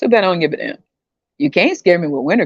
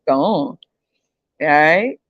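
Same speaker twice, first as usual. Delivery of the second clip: ordinary talk, nothing marked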